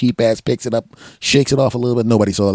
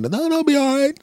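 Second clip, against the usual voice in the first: high, fast put-on voice; mock neighbor; 3 dB louder than usual. The neighbor protesting the thrown hot dog, high mock voice. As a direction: squawk the question like the neighbor.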